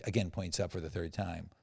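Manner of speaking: ordinary delivery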